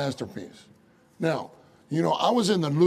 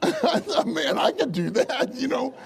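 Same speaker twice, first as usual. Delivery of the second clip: high, laughing self-mockery; 9 semitones higher than usual. Preacher mocking modern art to his congregation, laughing. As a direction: laugh your way through the boast.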